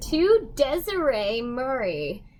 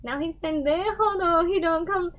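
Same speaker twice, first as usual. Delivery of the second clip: normal talking voice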